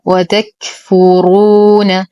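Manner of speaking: وتكفرون with a breathy ك and a heavy ف-ر; slow tajweed recitation. Shown as a demonstration. Teacher recites the word for students, slow measured chant. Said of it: "نهمس الكاف".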